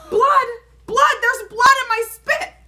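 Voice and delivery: loud, high shrieks of blood; hollering. A narrator's reading. Girl seeing pink spit water, loud shrieking holler. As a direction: screech it in alarm. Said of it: "I hollered some more".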